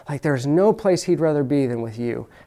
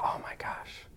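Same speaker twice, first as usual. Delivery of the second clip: awed whisper; 6 dB quieter than usual. Retold or imitated. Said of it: "hear your wife's voice and go".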